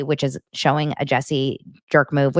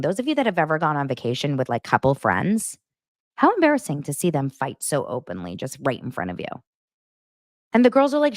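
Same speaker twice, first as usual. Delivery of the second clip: ordinary talk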